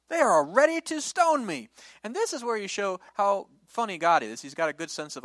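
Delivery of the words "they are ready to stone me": high, loud panicked plea; desperate outcry. Acted. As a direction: cry out that they'll kill you. Moses cries out to God.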